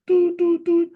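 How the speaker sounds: three high, loud toots; broken shofar hoots; shvorim shofar calls. Retold or imitated. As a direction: imitate a horn in broken notes. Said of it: "then they do shvorim".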